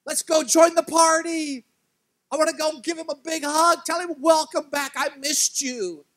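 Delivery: high, loud, slow and gushing; joyful welcome. Pretend. Imagined older brother eager to hug his returned brother, gushing. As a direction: overflow with happy relief.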